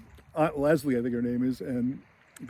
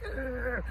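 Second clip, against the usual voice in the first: high, muted growl of frustration; angry growl; 10 semitones higher, 8 dB quieter than usual. Retold or imitated. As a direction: imitate a kid's exasperated growl, softly. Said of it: "it gets him angry".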